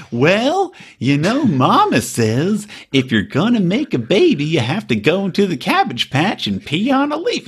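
slow, singsong country-bumpkin impression; Kenneth. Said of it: "a fucking hillbilly hick".

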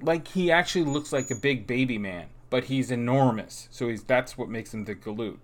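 ordinary talk

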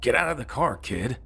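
high mock scream at a kid. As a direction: yell it in a high, strained voice. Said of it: "then just screams".

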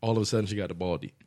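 quick repeat. Shown as a demonstration.

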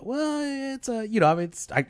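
high-pitched, stalling hesitation; acted hedging. Pastor asked what kind of church, awkward high hedging. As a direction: squirm upward, putting off the answer.

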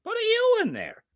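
high, swooping cartoonish complaint; silly exasperated voice. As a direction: squawk it in mock exasperation. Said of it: "I really have to get rid of this voice".

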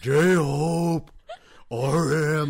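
loud, drawn-out mock bellows; man-voice yelling. Imitating girl who transforms when yelling, man-voice bellow. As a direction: bellow each name like a transformed fan. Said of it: "her man voice comes out".

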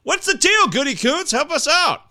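high-pitched, playful pleading shout; rising, hammed-up appeal; mock calling out. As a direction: call out to him in mock exasperation.

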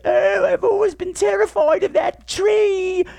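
very high, drawn-out mock wail; slow, exaggerated squeal of terror; mock whiny girl. Podcaster mocking the film's girl, high whiny wail. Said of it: "she's like".

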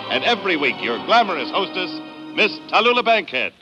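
normal talking voice

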